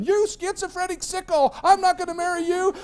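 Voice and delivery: imitating a fiancée's high, loud screaming; furious shriek. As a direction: shriek it in acted outrage, pitch way up. Furious fiancée walking out, acted.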